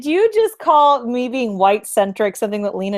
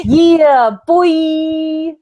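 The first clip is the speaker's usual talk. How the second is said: hype shout; 9 semitones higher, 8 dB louder than usual. Real, not acted.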